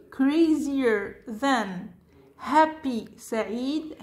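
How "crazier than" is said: slow model reading. Shown as a demonstration. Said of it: "teacher models comparative".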